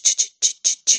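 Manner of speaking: breathy unvoiced ch-ch-ch burst like a remote clicking; mimed switch noise. Young man miming a video remote to Larry, rhythmic ch-ch sound. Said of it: "he mimed".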